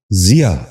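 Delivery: ضیا starting with "zi", "zi-yaa"; correct pronunciation. Shown as a demonstration. Teacher giving viewers the model, correct pronunciation. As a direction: say ضیا as ziyaa. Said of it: "دوبارہ سنیے".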